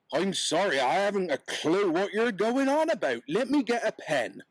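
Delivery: a puzzled peddler, lightly voiced. Peddler answers the hart.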